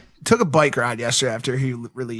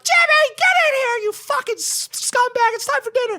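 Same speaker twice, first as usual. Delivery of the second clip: put-on very high screechy mom calling the kid inside; yelling mom. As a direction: shriek for Jimmy from the kitchen like an angry mom. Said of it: "And his mom's like".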